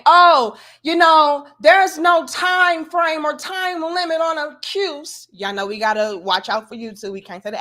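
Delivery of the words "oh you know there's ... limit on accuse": high, loud mocking of critics; mocking viewers. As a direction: parody the commenters' objection. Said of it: "I get what y'all are saying".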